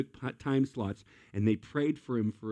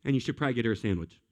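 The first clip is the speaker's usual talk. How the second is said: fast casual aside; much faster than usual. Retold or imitated. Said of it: "He says".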